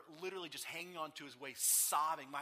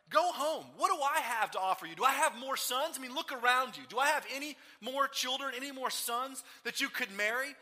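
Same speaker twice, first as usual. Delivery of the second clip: tirade; 8 semitones higher, 9 dB louder than usual. Acted. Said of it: "goes on this tirade".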